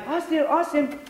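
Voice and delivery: high, sweet dog-calling voice; coaxing the dog. Acted. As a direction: call the dog over in a high voice. Calling the dog over stealthily.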